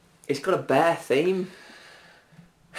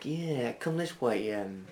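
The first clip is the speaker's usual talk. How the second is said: fox character; 7 dB quieter than usual. Acted. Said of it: "the fox is like".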